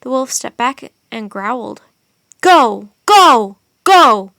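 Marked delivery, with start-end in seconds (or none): 2.4-4.3 three loud barked commands; shouting. A narrator's reading. Jack scaring off the wolf, loud repeated shouts. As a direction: hammer each go at the wolf. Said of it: "shouted Jack".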